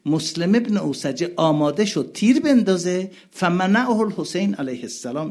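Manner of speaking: high, loud mourning recitation; impassioned preaching. Acted. Preacher crying out to the mourning gathering, impassioned. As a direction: cry it out to the mourners.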